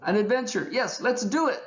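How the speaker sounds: very high, delighted exclamation; excited cry. A narrator's reading. Raccoon agreeing as he jumps up and down, thrilled. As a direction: cry out while jumping for joy. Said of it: "he cried".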